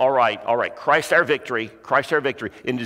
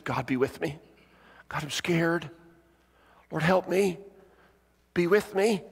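soft, higher, pleading little voice; scared child praying; 4 semitones higher, 4 dB quieter than usual. Retold or imitated. Girl alone in bed to God, scared pleading. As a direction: plead in short, separate phrases.

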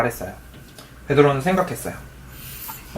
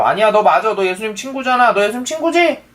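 higher-pitched pressing accuser; insistent accusation; 7 semitones higher than usual. A narrator's reading. Bystander steps closer to Peter, insistently accusing him. 다른 사람이 베드로를 추궁하는 대사.

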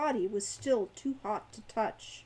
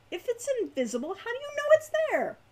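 rising, louder exasperated question; irritable; 13 semitones higher, 5 dB louder, faster than usual. A narrator's reading. Hawk irritated at his brother's invisible chest claim, exasperated. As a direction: snap the question back at him in disbelief. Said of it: "Hawk said irritably".

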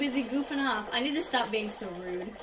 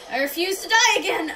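higher, louder protest; raised, determined outburst; defiant cry; 5 semitones higher, 6 dB louder than usual. Real, not acted. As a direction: cry out in stubborn refusal. Defiant in game danger.

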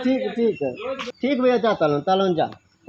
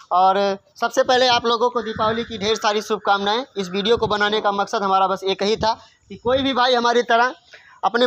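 steady address to viewers; vlog outro. Real, not acted. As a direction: wrap up the video for the audience.

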